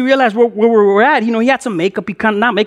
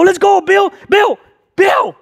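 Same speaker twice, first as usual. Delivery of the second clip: high-pitched urgent shout; acted frantic call; 11 semitones higher, 3 dB louder, slower than usual. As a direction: yell for a friend to run. Calling Bill to flee.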